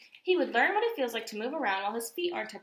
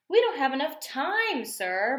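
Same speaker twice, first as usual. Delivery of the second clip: a touch of objection, pitched higher and louder; protesting; 5 semitones higher, 5 dB louder than usual. A narrator's reading. Marcy objects to the coach's training plan, mild protest. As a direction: mildly push back on the plan. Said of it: "Marcy protested".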